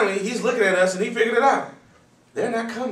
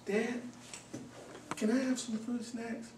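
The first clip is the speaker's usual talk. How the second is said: put-on higher, softer little-boy voice; small child; 5 semitones higher, 13 dB quieter than usual. Toddler asking dad for fruit snacks, high and soft. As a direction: ask sweetly like a toddler.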